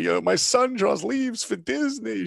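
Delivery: hammed-up proud mother showing off her son. Mother boasting to the community about her son, proud and high.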